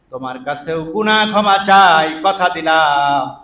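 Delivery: ordinary delivery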